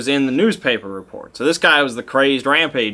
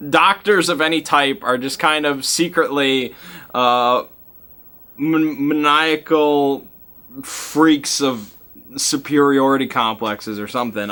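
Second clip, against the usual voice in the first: usual speaking voice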